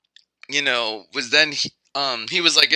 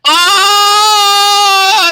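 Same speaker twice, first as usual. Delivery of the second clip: very high, loud cartoon scream; high screaming protesters; 8 dB louder than usual. Protesters crushed by a tomato, mock scream. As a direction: shriek as the tomato falls on them. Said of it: "they were going like".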